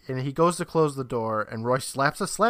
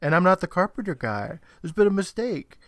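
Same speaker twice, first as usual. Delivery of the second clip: mild yelled protest, pitched up; half-yelled, higher-pitched objection; retold yell; 6 semitones higher, 4 dB louder than usual. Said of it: "Chris yells out".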